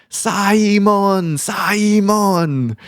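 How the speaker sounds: Simon as Sai-mon with an extra syllable; Korean pronunciation. Retold or imitated. Boss calling her employee, Korean-accented Simon. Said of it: "how a lot of the Koreans pronounce Simon".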